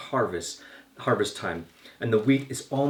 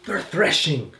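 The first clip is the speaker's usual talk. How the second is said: raised, higher voice, mildly shouted; accusing shout; 6 semitones higher, 4 dB louder than usual. A narrator's reading. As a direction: call it out like outraged accusers. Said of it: "and they're shouting".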